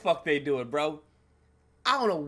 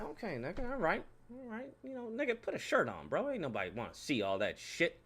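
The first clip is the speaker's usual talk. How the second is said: muttered aside; 11 dB quieter than usual. Retold or imitated. Muttered comment on shirtless jogger.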